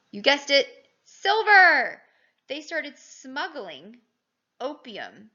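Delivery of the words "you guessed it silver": much louder and higher on silver; triumphant teacher reveal; excited reveal. Pretend. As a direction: announce it like a game-show answer.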